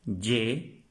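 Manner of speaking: usual speaking voice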